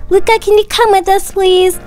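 ordinary delivery

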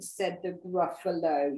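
ordinary delivery

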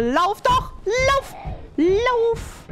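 high panicked shouts; being chased. Real, not acted. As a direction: yell at yourself to run. Fleeing, urging himself on.

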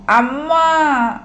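put-on long drawn-out, high child's wail; acted bawling call, stretched out; wailing for mother. A narrator's reading. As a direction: wail for Amma as tears start. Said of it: "అంటూ ఏడుపు లంకించుకున్నాడు".

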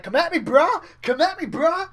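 high, loud macho taunt; bro-off shout. Retold or imitated. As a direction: yell it like a bro challenging. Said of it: "And they're like".

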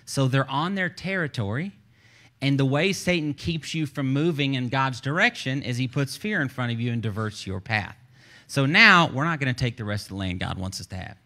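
usual speaking voice

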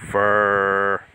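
a light Texas drawl on the word, voiced low; Southern accent. Joking how someone from Texas would say it, Southern accent. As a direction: say For like a Texan, half-joking. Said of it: "talking like I'm from down south".

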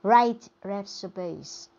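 "Right recipes" drawn out slowly, every sound clear; đọc chậm để học viên nghe rõ; slow demo. Shown as a demonstration. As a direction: spell it out slowly for learners. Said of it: "từ này đọc là right".

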